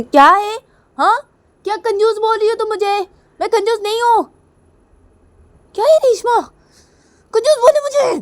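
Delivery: high, loud outraged screech; angry shouting. Acted. Kajal furious at being called a miser, shouting. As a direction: explode in offended shouts. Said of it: "तुम गुस्सा मत करो".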